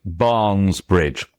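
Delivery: "Barnes Bridge" slow, each word stressed. Shown as a demonstration. Author shows two stresses side by side, slowed down. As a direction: draw out "Barnes" before an equally weighted "Bridge". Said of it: "people always slow down".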